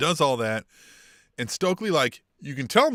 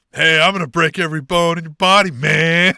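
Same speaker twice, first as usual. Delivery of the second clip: loud, gruff wrestler threat, shoulders squared; Jon Moxley impression; 3 semitones higher, 10 dB louder than usual. Co-host mocking Moxley's promo for a laugh, loud wrestler bark. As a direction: growl it out like Moxley cutting a promo. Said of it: "spot-on, perfect, uh, imitation of Jon Moxley".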